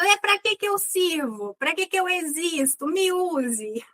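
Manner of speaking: lightly voiced, pleading little character; Didi speaking up. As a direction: voice a neglected auxiliary verb pleading to be used. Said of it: "o Didi tá aqui falando".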